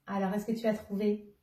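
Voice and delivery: usual speaking voice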